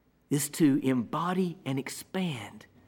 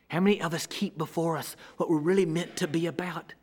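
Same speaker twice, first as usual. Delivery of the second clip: ordinary delivery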